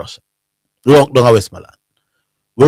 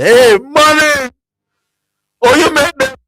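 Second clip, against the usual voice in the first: high, slow taunting jeer across the field; mock jeer; 13 semitones higher, slower than usual. Russia man jeering the Mannings fans, high taunting call. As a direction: jeer like a rowdy fan.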